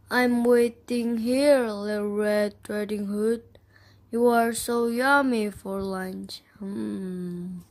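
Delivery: the wolf lurking in grandmother's bed; lightly voiced sly wolf. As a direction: purr it slowly like a waiting wolf. Wolf alone in bed, waiting.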